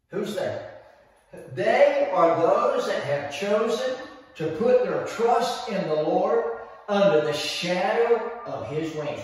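ordinary delivery

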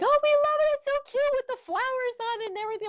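mock the fans cooing over the quilt; gushing admirers. Quilter mimics people praising her patched baby quilt, in a high gushing voice. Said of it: "they're just like".

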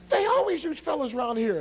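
high-pitched mimic of a woman protesting; complaining young lady. Turned-down volunteer protesting to the karate man, complaining imitation. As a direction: protest in a put-on girlish pitch. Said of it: "and she said".

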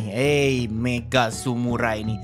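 ordinary delivery

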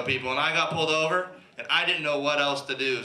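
usual speaking voice

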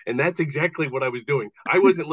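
ordinary delivery

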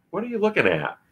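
high, bright river rat; Ratty voice. A narrator's reading.

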